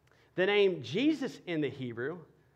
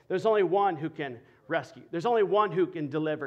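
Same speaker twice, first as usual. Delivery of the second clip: normal talking voice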